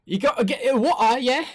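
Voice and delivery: high, choppy fake British with dropped t's; hammed-up British glottal stops; mock British accent. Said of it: "That, that's totally British".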